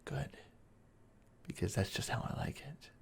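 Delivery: soft pleased whisper. Acted. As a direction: let it slip out hushed. Lover pleased at being creepy.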